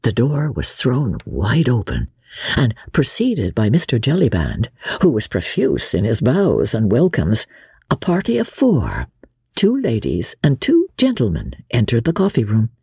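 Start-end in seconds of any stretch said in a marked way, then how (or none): none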